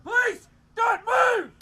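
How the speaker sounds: loud, high, slow assertive yell; officer showing how police order a suspect; police shout. Shown as a demonstration. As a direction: command the whole street to freeze. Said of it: "occasionally we have to go".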